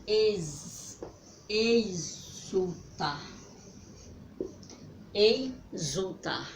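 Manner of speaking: "exultar" stretched very slowly; very slow model word. Shown as a demonstration. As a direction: draw out "exultar" so children hear each sound. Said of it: "a palavra que vai formar é". ("exultar" bem arrastado e lento)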